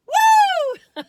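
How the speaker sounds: high, loud celebratory whoop. Real, not acted. Vlogger capping her cookie jingle, whooping. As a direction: let out a big high "woo".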